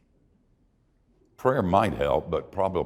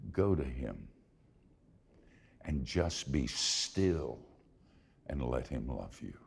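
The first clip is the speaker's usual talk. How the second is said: tender invitation; 5 semitones lower, 8 dB quieter than usual. Real, not acted. Tender altar-call invitation.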